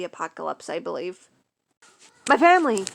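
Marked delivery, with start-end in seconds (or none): 2.3-2.9 loud, panicked cry; fearful yell. Acted. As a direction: cry out in sudden fear. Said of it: "he yelled in fear".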